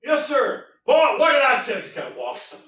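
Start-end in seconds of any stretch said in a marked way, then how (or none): 0.0-0.7 loud, high yes sir; recruit. Acted. Henderson answering the sergeant, loud. 0.8-1.8 loud, high scolding; sergeant. Acted. Sergeant chewing out Henderson, loud.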